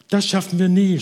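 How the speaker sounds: high, loud, exasperated huff; worn-out woman blurting out defeat; panting despair. Acted. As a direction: gasp it out in despair. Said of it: "schnaufte die Frau".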